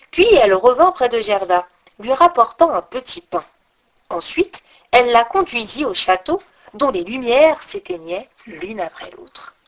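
ordinary delivery

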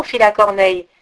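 usual speaking voice

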